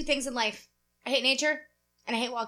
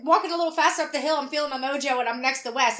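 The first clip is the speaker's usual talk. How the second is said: ordinary delivery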